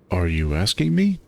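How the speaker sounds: plain reading voice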